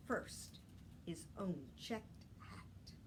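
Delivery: usual speaking voice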